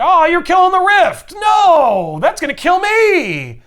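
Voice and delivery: high, drawn-out mock wail; hammed-up panic. Villain watching the rift torn apart, hammed-up wail. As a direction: cry out loud, stretching the last words.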